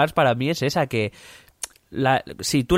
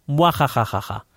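mustache twirling villain; 4 semitones higher than usual. Acted. Fake Trump plots, evil laugh.

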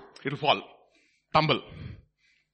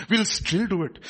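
usual speaking voice

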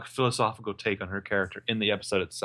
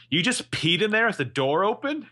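incredulous question; 10 semitones higher, 3 dB louder than usual. Retold or imitated. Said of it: "And Emily's like".

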